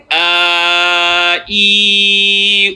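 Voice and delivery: A, I, U each held long and steady; stretched vowels. Shown as a demonstration. Teacher stretching vowels for two counts. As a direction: stretch A, I and U out from the stomach. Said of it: "take that a and stretch it out".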